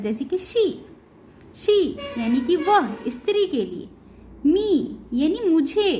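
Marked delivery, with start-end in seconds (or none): 0.4-0.7 शी with a long ई. Shown as a demonstration. Teacher giving an example to learners, शी with long ई. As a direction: hold the vowel in शी as ee. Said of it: "बड़ी ई के रूप में".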